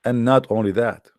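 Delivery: usual speaking voice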